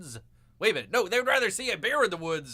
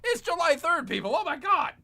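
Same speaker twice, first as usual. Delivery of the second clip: higher and louder, outraged disbelief; exasperated outburst; 11 semitones higher, 6 dB louder than usual. Real, not acted. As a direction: blurt it out in disbelief. Exasperated at the late law.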